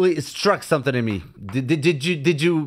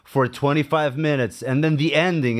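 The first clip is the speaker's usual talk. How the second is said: ordinary delivery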